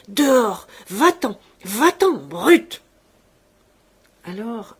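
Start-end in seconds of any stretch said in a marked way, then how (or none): 0.1-2.8 loud, explosive repeated orders; trembling rage. A narrator's reading. Shaking father throwing the servant out, in loud fury. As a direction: shout each word in a separate burst. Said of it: "Il répétait, tremblant de la tête aux pieds".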